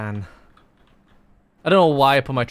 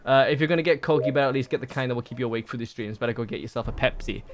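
ordinary talk, nothing marked

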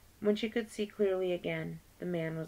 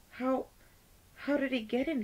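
shaky; 7 semitones higher than usual. Acted. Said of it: "Myrtle asked, shakily".

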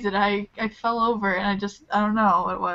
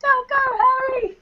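put-on pleading girl; 14 semitones higher, 4 dB louder than usual. Acting a Harry Potter scene.

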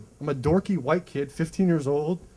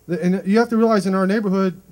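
ordinary delivery

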